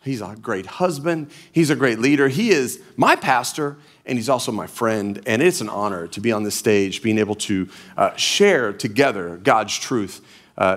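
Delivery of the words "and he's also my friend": usual speaking voice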